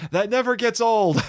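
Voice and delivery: high-pitched mock yell from the crowd; playful imitation of someone yelling out; imitated yell. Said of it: "who yelled out when that happened".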